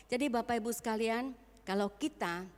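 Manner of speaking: usual speaking voice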